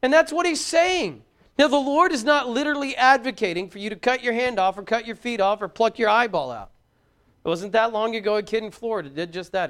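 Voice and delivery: loud, high fiery sermon voice, climbing; preaching at full force. Acted. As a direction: thunder the warning at the congregation.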